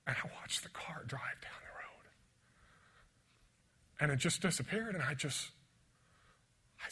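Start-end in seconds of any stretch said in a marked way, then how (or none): 0.1-2.1 whispered, grief-heavy memory; hushed whisper. Real, not acted. Preacher recalling his father driving away, whispering. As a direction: let the voice fall to a whisper.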